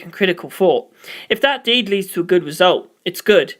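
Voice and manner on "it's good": plain reading voice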